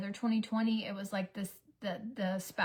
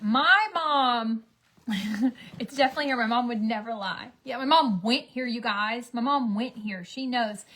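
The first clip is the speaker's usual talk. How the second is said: mock proud bragging; 2 semitones higher, 8 dB louder than usual. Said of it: "And then she was like".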